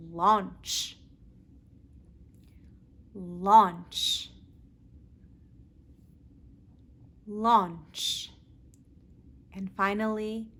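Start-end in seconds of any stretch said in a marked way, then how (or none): 0.1-8.3 launch, with a clear L; teacher repeating launch; modelled L word. Shown as a demonstration. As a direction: say launch slowly. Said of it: "Practice with me".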